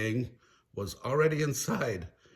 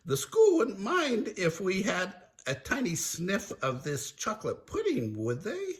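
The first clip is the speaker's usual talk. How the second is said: higher, goofy wheedling voice; Shaggy angling for food; 8 semitones higher than usual. Acted. Shaggy angling for a sniff of pudding, high wheedle. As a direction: plead lightly, pitch lifting at the end. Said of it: "Shaggy complained".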